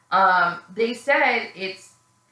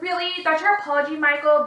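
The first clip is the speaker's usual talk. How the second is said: high-pitched mock incredulity; imitated indignant disbelief; 6 semitones higher, faster than usual. Girlfriend hears boyfriend's weak apology, mock indignant disbelief. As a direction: question him as a scornful girlfriend would.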